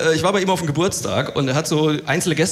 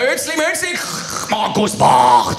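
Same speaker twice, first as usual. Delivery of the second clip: Swiss German incantation; 7 semitones higher, 5 dB louder, slower than usual. Retold or imitated. Swiss man announces the comedian like a spell, slow and loud. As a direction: intone the name like a curse. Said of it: "dann geht's weiter".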